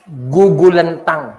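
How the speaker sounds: slow, weighty "Gugulantang"; drawn-out emphasis. Real, not acted. Preacher repeats key word for emphasis.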